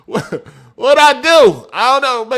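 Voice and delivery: high laugh into slow mock-innocent question; laughing feigned innocence. Real, not acted. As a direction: giggle and play dumb.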